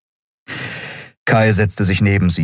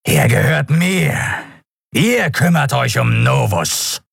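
high, slow, rasping creature voice; forceful reptilian rasp; rasping reptile; 7 semitones higher than usual. A narrator's reading. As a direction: claim your prey, words drawn out. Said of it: "schnarrte der Draxyl".

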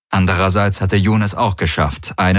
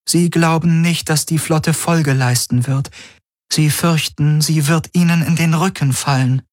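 Helix relaying; 9 semitones higher than usual. Acted.

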